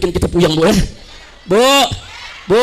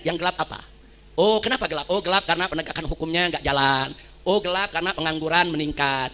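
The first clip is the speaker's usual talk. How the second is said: lower, softer acted listening voice; calm, reasonable official; 6 semitones lower, 4 dB quieter than usual. Model official hearing out students' grievances, calm and conciliatory. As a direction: play an understanding official, quietly receptive.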